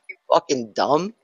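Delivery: normal talking voice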